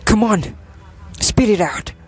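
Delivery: impatient order. Acted. Lord to a hesitant messenger, impatient order. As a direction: press the messenger to speak.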